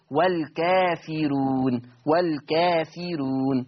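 والكافرون drawn out very slowly with a light, thin راء; thin raa. Shown as a demonstration. Teacher reciting for students, slow with a thin raa. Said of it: "مرققة".

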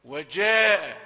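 "وجاء" with hard dj and long vowel; loud drawn-out "وجاء", jeem stopped firmly. Shown as a demonstration. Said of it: "اجعلها شديدة". ("وجاء" بجيم شديدة ومد طويل)

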